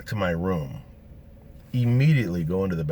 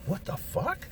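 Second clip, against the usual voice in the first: much higher, softer squeak of disbelief; stunned disbelief; 13 semitones higher, 7 dB quieter, slower than usual. Retold or imitated. Teller reacting to the crowd, a high softer squeak. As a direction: squeak it out in shock. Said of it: "and I'm like".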